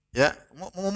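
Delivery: usual speaking voice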